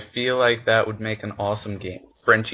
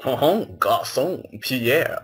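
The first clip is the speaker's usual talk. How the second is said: put-on French accent; much slower than usual. Calling to a waiter Pierre, mock French accent. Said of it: "attempt to try and do a French accent".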